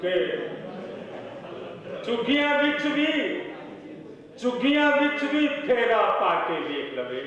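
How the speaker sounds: measured mushaira delivery; ghazal recital. Acted. Poet opening a ghazal for the audience, declaimed recital. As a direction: declaim the opening verse, pausing between phrases.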